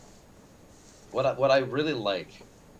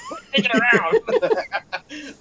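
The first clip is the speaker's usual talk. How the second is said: slow, high, laughing Peppy line; imitating Peppy, laughing; 10 semitones higher, much slower than usual. Recalling the sixty-four voice actor, high slow laughing quote. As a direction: drag it out while cracking up. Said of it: "God bless that voice actor".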